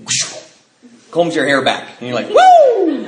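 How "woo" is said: high, loud rush-of-joy whoop; whooping with a laugh at the buzz; joyful whoop. Retold or imitated. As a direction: let out a sudden high whoop of release. Alcohol rush hits, joyful whoop.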